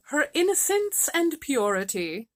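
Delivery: a hint of an oracle; mystical reverence. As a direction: intone it like sacred truth. Finn woman mystic pronouncement.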